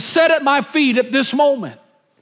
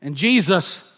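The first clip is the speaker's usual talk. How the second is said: usual speaking voice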